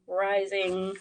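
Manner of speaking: normal talking voice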